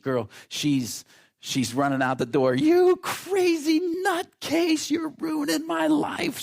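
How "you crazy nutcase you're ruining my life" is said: shrill, much higher, louder hysterical shriek; teenage scream. Retold or imitated. Teen daughter screaming at her father, shrill hysterical shriek. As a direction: shriek like a mortified teenage girl. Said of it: "screaming at my dad and my grandfather".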